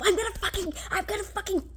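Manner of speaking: high, frantic rant that builds and speeds up; yelling to myself. Retold or imitated. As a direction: rant hysterically at yourself, racing by the end. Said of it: "I yelling to myself".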